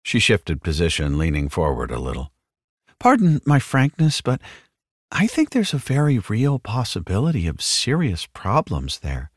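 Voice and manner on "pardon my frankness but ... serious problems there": earnest saleswoman. A narrator's reading. Wells voiced, leaning forward.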